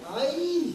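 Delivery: sharp high mock grunt from the coach; coach's pinch grunt. Coach pinching the speaker, high mock grunt. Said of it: "pinch me and go".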